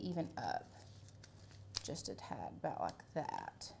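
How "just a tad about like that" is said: whisper. Real, not acted. Fine-adjusting a piece, to herself.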